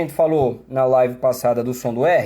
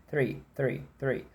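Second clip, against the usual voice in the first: quick "Three" with a light TH; rapid natural "three"; fast "three"; 4 semitones lower, 11 dB quieter, slower than usual. Shown as a demonstration. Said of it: "se eu falo rápido".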